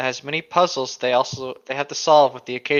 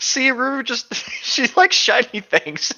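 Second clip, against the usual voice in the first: laughing; 9 semitones higher, 3 dB louder, slower than usual. Real, not acted. Laughing about Ruru.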